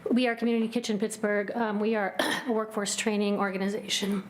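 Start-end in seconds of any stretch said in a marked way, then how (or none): none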